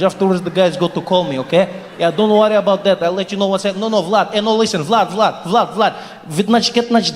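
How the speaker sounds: mock Russian phone chatter like scratched records; imitating a Russian guy on the phone; Russian accent. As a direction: rattle off Russian-accented English and Russian gibberish. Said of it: "DJ is scratching English".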